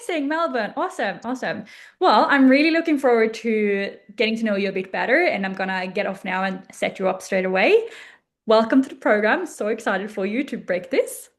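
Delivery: ordinary talk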